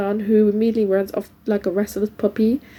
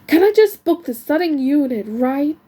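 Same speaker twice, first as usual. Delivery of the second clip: exasperated raised voice, pitched up; shouted; 5 semitones higher, 4 dB louder than usual. A narrator's reading. Customer trying to book a unit, exasperated shout. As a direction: snap it out higher, patience gone. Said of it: "Shouts, holding up both his hands".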